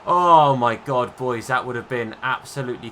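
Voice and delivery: real loud relief after a near goal; relieved exclamation. Real, not acted. Near-miss conceded shot.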